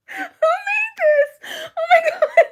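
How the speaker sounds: very high, slow squeal of laughter; laughing. Real, not acted. Vlogger cracking up at the quiz maker, high squealing laugh.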